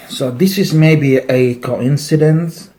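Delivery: ordinary talk, nothing marked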